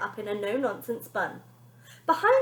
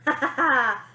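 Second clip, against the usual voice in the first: fake chuckle; 4 semitones higher, 8 dB louder than usual. A narrator's reading. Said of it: "chuckled to herself".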